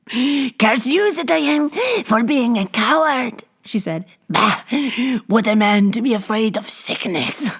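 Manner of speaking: put-on high, cackling old hag jeer; mocking hag. A narrator's reading. Old hag jeers at the fleeing gatekeeper, mocking. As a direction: scorn the coward.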